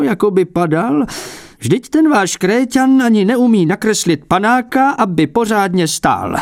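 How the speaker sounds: put-on a prickly old painter feigning modesty; fussy old abbot. A narrator's reading.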